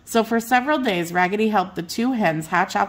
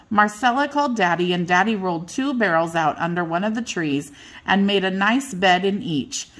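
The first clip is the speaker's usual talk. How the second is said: usual speaking voice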